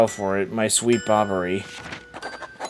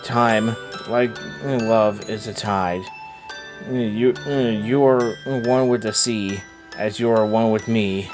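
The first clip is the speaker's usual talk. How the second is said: tender letter reading; 3 semitones lower, 6 dB quieter than usual. Acted. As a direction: read a dead wife's letter gently. Reads the dead wife's letter.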